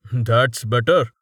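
slow, smug and dismissive; a bully who blames others; arrogant brute voice. A narrator's reading. Thrust, smug.

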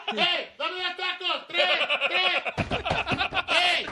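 very high, loud cartoonish yelling; hammed-up squealed food orders; background voices. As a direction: imitate excited customers shrieking for tacos. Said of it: "you'd hear some like".

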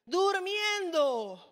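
slow, stretched answer; slowly revealing Jesus was asleep; drawn-out reveal. Shown as a demonstration. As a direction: draw the word out for effect. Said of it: "dramatic reveal".

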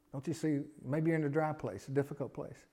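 ordinary delivery

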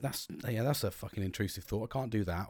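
low, soft self-talk; hushed, like a private intrusive thought; quiet inner voice. Retold or imitated. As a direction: mutter it to yourself. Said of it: "I'm like".

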